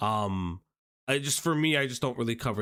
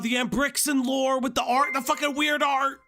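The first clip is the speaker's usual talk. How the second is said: excited high; 10 semitones higher than usual. Real, not acted. Excited recall of art.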